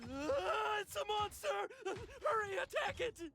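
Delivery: high panicked cry to attack; panicked alarm. Acted. Frightened man sees the crab and panics. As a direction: shriek at the monster in fear.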